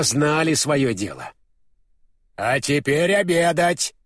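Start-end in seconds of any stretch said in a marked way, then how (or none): 2.4-3.9 commanding. A narrator's reading. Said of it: "приказала она".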